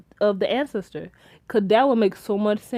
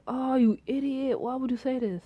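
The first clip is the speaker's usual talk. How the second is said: whispered scolding; 3 semitones higher, 8 dB quieter than usual. Retold or imitated. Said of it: "And so I'm like".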